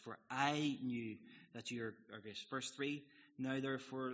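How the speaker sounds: slow, loud Bible reading; scripture reading. Acted. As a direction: read the prophet's prayer out, with weight. Reads Jonah's prayer aloud.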